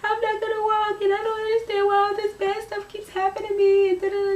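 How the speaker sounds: put-on high, crying wail to her boyfriend; retelling a tearful phone call, voice way up. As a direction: sob it out high and pleading. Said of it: "I just called my boyfriend, and I'm like".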